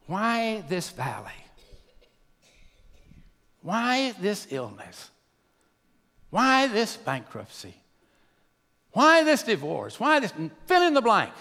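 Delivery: drawn-out lament that gathers force; pleading questions to God. Acted. Acting out believers questioning God.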